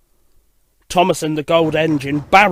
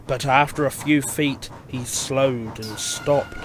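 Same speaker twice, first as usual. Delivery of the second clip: ordinary delivery, nothing marked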